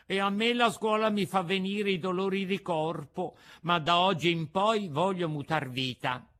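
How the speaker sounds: a lightly voiced naughty boy promising to change; Pinocchio's voice. A narrator's reading.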